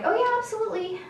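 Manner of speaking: high, over-eager mock agreement; breezy compliance. Humoring the boss from rules, breezily compliant. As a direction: agree blithely, voice lifted.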